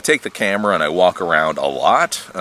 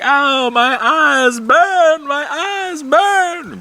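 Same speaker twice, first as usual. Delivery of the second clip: much higher, very slow mock wail about burning eyes; mock complaining; 3 dB louder, much slower than usual. Running inside from allergies, hammed-up high slow wail. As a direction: stretch it out high like an overdramatic victim.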